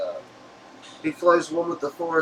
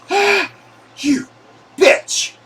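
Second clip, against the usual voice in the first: pained shout; 11 dB louder, much slower than usual. Acted. Villain's hand cut by lightsaber.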